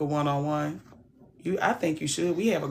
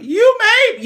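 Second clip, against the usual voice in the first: mock crying guest; 11 dB louder than usual. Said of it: "they're like".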